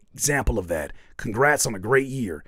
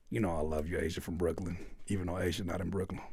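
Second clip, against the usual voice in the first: soft affectionate; 8 semitones lower, 11 dB quieter, faster than usual. Real, not acted. Softened affectionate aside.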